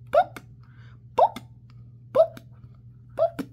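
loud boop pops; playful cork pops; popping cork. Shown as a demonstration. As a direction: pop each boop like a cork. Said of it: "He can go like a cork".

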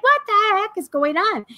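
high, startled question; can't believe what's happening to you; baffled surprise. Retold or imitated. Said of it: "I was like".

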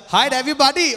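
loud, put-on greeting voice; trying out an accent. Shown as a demonstration. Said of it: "what kind of accent do I pick up".

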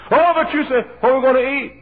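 high, loud mimicked fretting; mock worried wail. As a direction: wail it like a panicky churchgoer, hammed-up. Mimicking worried congregation's objection.

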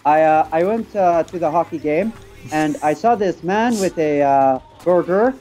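hammed-up French-Canadian accent. Shown as a demonstration. Player showing friends a hockey game tale, Canadian accent. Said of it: "Canadians, ladies and gentlemen".

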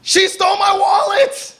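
high, urgent shout after the thief; a robbed man shouts as he chases the thief; shouting for help. Retold or imitated. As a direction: yell it as you chase her. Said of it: "you just hear the man chasing him going".

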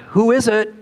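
lightly voiced call from above; crying out. A narrator's reading. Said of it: "A voice cried out".

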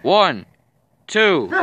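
referee count. Acted. Calling the pin count.

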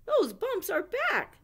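high, loud alarmed shout. Acted. Owl finding the bumps back again, shouting. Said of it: "shouted Owl".

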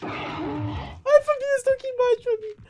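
high, fast panicked cry; alarmed yelp to a teammate. Real, not acted. Spiders climbing up, panic.

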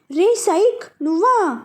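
high, indignant, irritated; angry recognition of the rival; angry question. A narrator's reading. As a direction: ask it annoyed at seeing him. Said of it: "అని అడిగింది కోపంగా".

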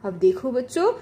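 ordinary delivery

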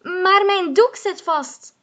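high, loud frustrated call. Acted. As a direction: cry out in protest. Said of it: "roept Mie".

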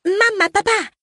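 put-on high little-girl voice, pleading; young girl asking. Mar asking her parents to stay with friends, high girlish plea. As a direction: ask like a small child begging to stay.

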